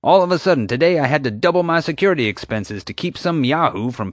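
park owner ranting about money; rising rant. Acted. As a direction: lecture them, getting worked up.

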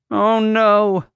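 high, loud frightened cry; alarmed cry. Acted. Keeper seeing elephants panic, alarmed cry. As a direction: cry out in dismay. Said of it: "Bonnie cried".

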